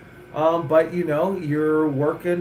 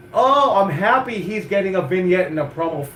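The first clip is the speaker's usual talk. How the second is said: mock happy; 5 semitones higher, 5 dB louder than usual. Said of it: "There's too much".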